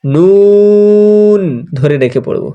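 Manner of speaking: নুন drawn out long before the rest; held nun. Shown as a demonstration. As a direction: hold নুন for three alif, then go on. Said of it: "তিন আলিফ পড়ব". (নুন ধরে রেখে টানা)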